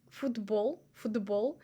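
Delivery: football with t turned to d; football said like fudbol; football, t voiced to d. Shown as a demonstration. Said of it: "more like D sound than T".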